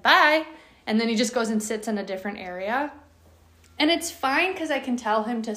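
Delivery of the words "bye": high, loud playful bye; cheeky dog voice. Retold or imitated. Dog darting away from owner, high playful bye. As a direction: toss it off as a teasing dog. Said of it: "he's like".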